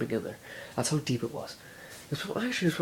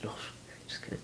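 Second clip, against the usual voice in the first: whispered just kidding; 11 dB quieter, much faster than usual. Real, not acted. Whispered retraction after joke.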